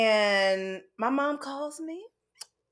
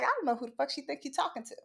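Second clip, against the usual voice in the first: sassy head tilt; 3 semitones higher, 8 dB quieter, much faster than usual. Retold or imitated. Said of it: "cock your head to the side like".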